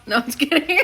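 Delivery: laughing it off. Real, not acted. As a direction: giggle through it.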